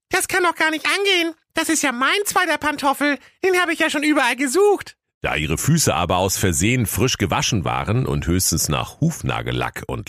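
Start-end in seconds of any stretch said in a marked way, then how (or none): 0.1-4.9 very high squealing false claimant; Annette calling out. A narrator's reading. Daughter claiming the slipper as hers, high squeal. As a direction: squeal in put-on surprise. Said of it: "Annette rief".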